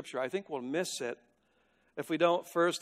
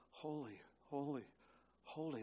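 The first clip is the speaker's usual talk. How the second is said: very soft, worshipful repetition; hushed reverence; 14 dB quieter than usual. Acted. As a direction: let each word fall softly in awe. Echoing the creatures' endless worship.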